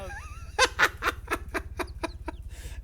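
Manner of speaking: high airy laugh; breathy laugh. Real, not acted. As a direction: wheeze out a laugh. Laughing at own joke.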